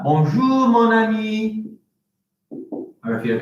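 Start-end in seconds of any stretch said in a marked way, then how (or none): 0.0-1.7 bonjour, mon ami sung up high, French style; friendly French greeting. Shown as a demonstration. Teacher to pupils, a sung-up French greeting. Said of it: "I could say that".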